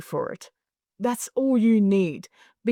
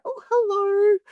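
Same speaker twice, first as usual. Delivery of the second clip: high, drawn-out polite hello; puzzled, high-pitched politeness; awkwardly surprised greeting; 10 semitones higher, 3 dB louder, slower than usual. Retold or imitated. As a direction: greet an unexpected hugger, pitched way up. Startled greeting to hugging stranger.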